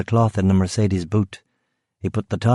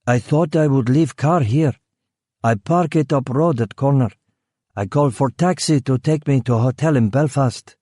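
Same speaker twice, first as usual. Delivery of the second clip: put-on higher, broken-English Eastern European accent; vague Eastern European accent; 5 semitones higher than usual. Killer keeps up his foreign act with the guard, vague Eastern European accent. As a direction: play the clueless foreign driver.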